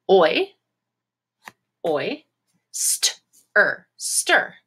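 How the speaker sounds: Oi and st-er sounded apart, then stir; tapping out syllables. Shown as a demonstration. Teacher tapping out syllables with the class. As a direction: pound out each syllable. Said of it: "tap this out and pound this out".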